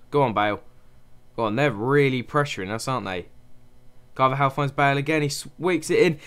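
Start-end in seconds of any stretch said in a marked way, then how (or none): none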